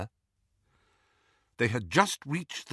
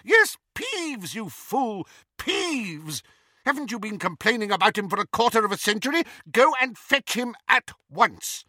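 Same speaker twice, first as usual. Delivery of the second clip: exasperated scolding; 11 semitones higher, 4 dB louder than usual. A narrator's reading. McGonagall scolds Filch.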